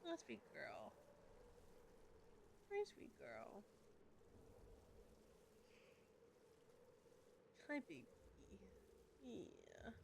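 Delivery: hushed, near-whispered cooing; soft cooing. Real, not acted. Streamer softly cooing to her cat, very soft. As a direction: murmur sweetly to the cat.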